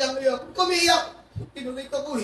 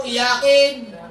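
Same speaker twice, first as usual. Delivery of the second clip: loud mocking "Iyakin"; a loud, scornful jab; mocking crybaby; 7 dB louder than usual. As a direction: taunt the crybaby. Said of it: "Sabi mo".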